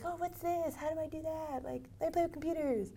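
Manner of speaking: put-on high, soft wondering child; curious kid voice. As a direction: marvel at it like a kid. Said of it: "You were like".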